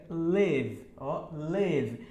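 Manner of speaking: "live" short and closed, lev; E sound live. Shown as a demonstration. Teacher drilling "live" with the E sound. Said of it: "ele tem som de E".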